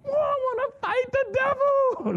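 high mock-eager cry; eager self-quote. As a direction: ham up your younger zealous self. Young convert's eager self-quote.

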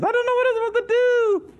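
very high, squeaky whine; exaggerated hippie whine. Preacher mocks directionless believers in a squeaky high voice. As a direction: push the whine to a cartoon squeak. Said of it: "the hippies followed us".

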